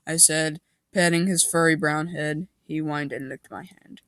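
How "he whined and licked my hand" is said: ordinary delivery, nothing marked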